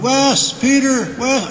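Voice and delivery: high-pitched call for help; acted-out urgent calling; retold yelling out. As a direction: call out across a dark car park. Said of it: "So I start yelling out".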